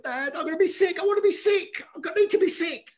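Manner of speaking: very high-pitched mock desperate whine; panicked boy, imitated. Scared young boxer warns dad he'll be sick, acted high panicky plea. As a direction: plead in rising panic, acted out. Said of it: "I'm in tears".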